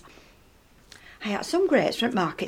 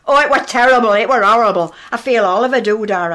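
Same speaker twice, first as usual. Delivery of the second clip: louder mock-shocked complaint; hammed-up distress; 7 dB louder than usual. Edith telling Missus Smith about the kiss, hammed-up louder mock distress. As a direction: play up the horror for the neighbour.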